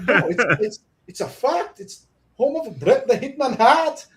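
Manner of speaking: high-pitched mock-Scottish insistence; imitated Scottish accent. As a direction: insist like an excitable Scotsman. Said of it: "Scottish dude".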